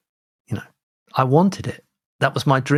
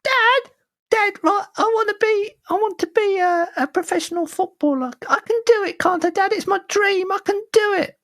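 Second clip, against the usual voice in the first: put-on pleading, very high little-boy voice; high-pitched eager kid. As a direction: beg Dad in a put-on child falsetto. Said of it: "it's my only kid voice I've got".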